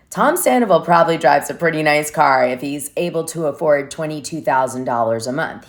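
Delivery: ordinary talk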